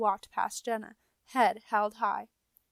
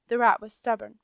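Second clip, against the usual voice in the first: ordinary delivery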